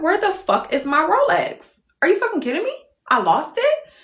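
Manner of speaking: high-pitched, acted disbelief; incredulous. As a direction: question yourself in rising panic. Acted disbelief at losing watch.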